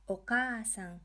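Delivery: KA in "Okaa-san" held long; extended "aa" in Okaa-san; long vowel. Shown as a demonstration. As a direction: stretch the "kaa" of Okaa-san over two beats. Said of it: "we are extending the ka syllable".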